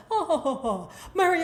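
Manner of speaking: high, chuckling British host; badly faked British "oh, oh, oh"; mock British accent. Messy host greeting Mary on a British show, mock British accent. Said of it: "pretend I'm doing a British accent".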